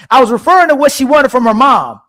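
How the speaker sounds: teasing a viewer by hamming up her message, mock voice; mocking imitation of Red. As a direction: read it like a petty caricature of her. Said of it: "Red don't sound like that".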